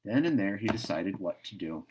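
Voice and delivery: ordinary delivery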